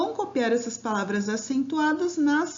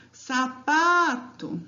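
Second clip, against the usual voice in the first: "sapato" very slowly, with PA held long; drawn-out "sapato", weight on PA; calling the word out; 3 semitones higher, much slower than usual. Shown as a demonstration. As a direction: call out "sapato", stretching the stressed PA. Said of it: "vocês podem chamar a palavra".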